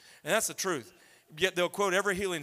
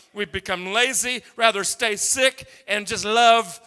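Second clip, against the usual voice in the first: fiery preaching; 9 dB louder than usual. Acted. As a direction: hammer each line at the congregation. Preaching at full force.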